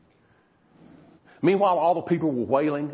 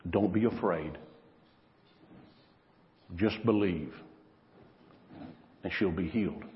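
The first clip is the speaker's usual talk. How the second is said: soft, lower comforting words; calm a frightened father softly; Jesus quietly reassuring the grieving father; gentle reassurance; 7 semitones lower, 7 dB quieter than usual. Acted.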